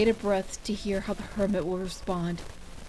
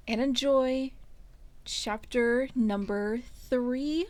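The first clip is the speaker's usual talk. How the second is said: ordinary delivery, nothing marked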